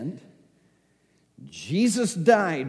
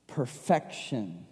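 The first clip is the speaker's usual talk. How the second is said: ordinary talk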